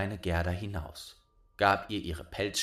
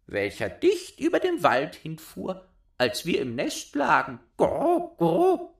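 much higher, louder bird voice; cooing wood doves; 10 semitones higher, 6 dB louder than usual. A narrator's reading. Doves telling Gerda about Kai, high cooing voice. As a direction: coo like a dove.

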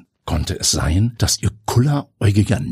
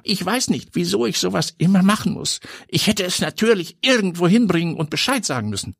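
put-on much higher, boyish voice; young nephew voice; 11 semitones higher than usual. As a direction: play the chirpy young nephew. Neffe erklärt das Paket. (viel höhere, jungenhafte Stimme)

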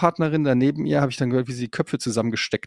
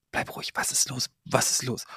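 soft voiceless calming question; whispering; 9 dB quieter than usual. Retold or imitated. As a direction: hush him, whisper urgently. Wife whispers back to him.

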